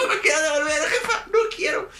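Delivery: mock scared crying. Scared kid begging his mom before the ride, laughing mock panic. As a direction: beg to get off, hammed-up panic. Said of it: "yo estaba llorando".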